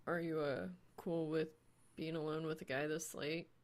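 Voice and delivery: soft, hesitant question; shy quiet voice. A narrator's reading. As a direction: ask it softly, a bit nervous. Soul asks shyly.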